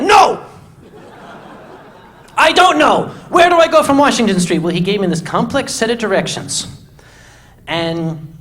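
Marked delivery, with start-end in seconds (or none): 0.0-4.6 high, loud cutting-in shout; frustrated yell. Retold or imitated.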